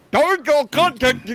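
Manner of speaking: slow high-pitched send-up of a co-host; Dave doing Tim. Acted. Host showing his co-host's impression, high mocking voice. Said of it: "I think I can do Dave doing".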